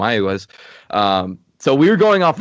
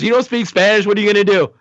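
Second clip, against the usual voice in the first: higher, taunting brother voice; mocking brother; 9 semitones higher than usual. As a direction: needle him like a smug brother. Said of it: "my brother goes".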